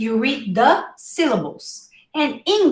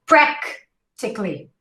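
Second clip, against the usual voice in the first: "Practically" with PRAK set apart, tik-lee swallowed; "Practically" as PRAK, pause, quick tik-lee; stressed first syllable. Shown as a demonstration. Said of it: "it's a stress syllable".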